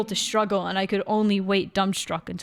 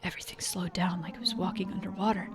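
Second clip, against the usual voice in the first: hushed narration; 7 dB quieter, faster than usual. A narrator's reading. Narrating slow-motion fight.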